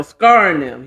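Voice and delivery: normal talking voice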